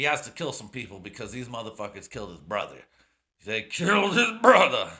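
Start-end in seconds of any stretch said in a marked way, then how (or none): 3.5-4.9 high, loud, hammed-up movie anguish; big, cheesy shouted melodrama; mock dramatic outcry. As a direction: wail it like an overblown revenge hero. Hammed-up mocking repeat of plot.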